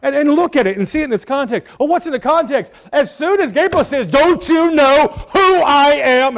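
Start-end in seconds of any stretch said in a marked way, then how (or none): 4.1-6.4 loud, high, slow indignant rebuke; emphatic rebuke. Shown as a demonstration. Gabriel rebuking Zacharias in the Greek mood, loudly emphatic. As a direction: hammer out each word as a rebuke. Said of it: "I'm putting it that in mode".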